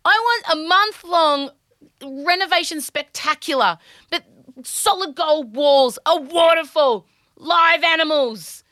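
high, slow showman build; grand extravagance. Real, not acted. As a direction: sell the fantasy big. Extravagant fantasy wish list.

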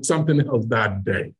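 usual speaking voice

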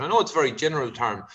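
normal talking voice

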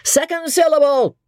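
high ringing call of Second syllable; high stage cry; cried announcement. Acted. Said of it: "a voice cries out".

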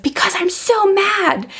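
imitated high, drawn-out child's whine; upset small child. Upset daughter answering her mother, imitated childlike wail. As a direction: stretch it out high like a cross three-year-old. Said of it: "she was like".

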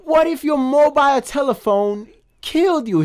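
high mock English accent; impersonating a British rocker's scoff. Retelling the movie to his cohost, mock British accent. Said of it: "It's like".

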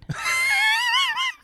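very high-pitched squeal of laughter. Real, not acted. Guessing countries for co-host, breaking into squealing laughter. Laughing at own shaky answer.

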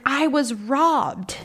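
high, drawn-out imitation of a distressed mom; retold frantic mom. Mom breaking news of robbery to daughter, imitated as frantic. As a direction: stretch it out high, reporting mom's shock. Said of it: "panicking, frantic".

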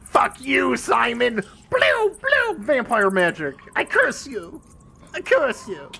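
hammy vampire cursing; laying a curse. Pretend. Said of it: "Dracula was like".

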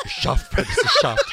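laughing loudly through the words. Real, not acted. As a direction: say it while cracking up. Mocking lisp while laughing.

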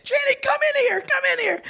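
very high falsetto summons; hammed-up squealing guest; excited party guest. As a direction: holler for a friend in mock delight. Playing guest calling someone over.

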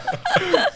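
squealing laugh, falling off; high burst of laughter. Real, not acted. Laugh after joke. (高声笑出来)

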